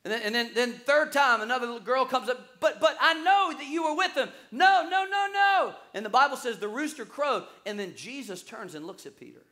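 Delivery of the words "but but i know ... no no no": high, loud acted accusation and denial; raised-pitch, insistent back-and-forth; heated retold exchange. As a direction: blurt it high and loud, protesting.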